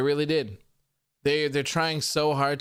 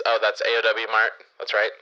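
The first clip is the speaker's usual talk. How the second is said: soundboard voice; 5 dB louder than usual. Shown as a demonstration. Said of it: "the Finding Philip voice".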